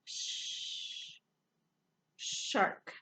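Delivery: "shark" with a long whispered sh before it; stretched sh sound. Shown as a demonstration. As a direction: draw out the sh then say "shark". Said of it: "have the S H sound".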